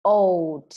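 put-on "Old" said loud, ending on a clearly released D; final d released. Shown as a demonstration. Teacher drills the final D sound for pupils, loudly. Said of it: "Bật âm D cuối nào".

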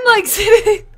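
high and loud through laughter; giggling as you say it; real laughter; laughing. Real, not acted. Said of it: "I'm laughing too".

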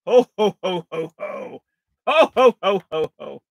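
booming Santa ho ho ho; hearty Santa Claus laugh. Acted. Santa greeting.

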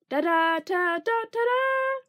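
high, slow, trumpeting bugle call; bugle imitation. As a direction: sing it out like a camp bugle. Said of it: "the bugle blasted".

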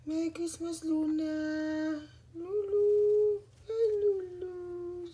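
high, loud singsong calls; doting pet greeting. Real, not acted. Owner wishing Luna merry Christmas, high loud pet-talk. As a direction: sing out the name to a pet.

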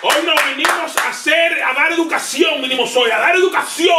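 high, shouted hype intro; lucha libre announcer. Acted. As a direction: sell it like a ring announcer. Hyped podcast intro continues.

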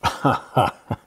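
short disbelieving laugh; scoffing laugh. Acted. Brushing off Lafont's claim with a scoffing laugh. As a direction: laugh off the accusation. Scoffing at being called a doll.

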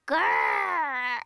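low playful growl like a toy dinosaur; pretend dinosaur growl. Peppa pretending to be a dinosaur for George, low growl. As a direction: growl low to tease your little brother.

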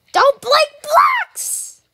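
high, slow drawn-out yell; shout. Real, not acted. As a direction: scream it at the enemy. Yelling at game opponent.

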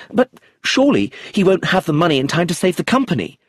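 a young man's narrated anger, moderately voiced; angry. A narrator's reading. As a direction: push back hard at the family. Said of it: "said Eustace angrily".

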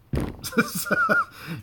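sudden high-pitched laugh; high giggle. Real, not acted. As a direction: let a high giggle burst out. Laughs at own accent remark.

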